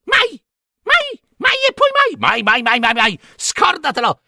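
high, rapid-fire screechy refusal; refusing hags. A narrator's reading. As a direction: squawk a stubborn crone refusal.

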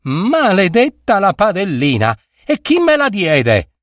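high-pitched, resentful outcry; bitter curse. A narrator's reading. Hungry girl glaring at the pan, cursing bitterly. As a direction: curse it high, close to tears. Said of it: "si sentiva gorgogliare in gola".

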